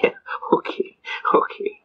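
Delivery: whispered, resigned okay; voiceless, softly conceding; mock defeated whisper. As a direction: imitate a beaten judge giving in under his breath. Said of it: "He says".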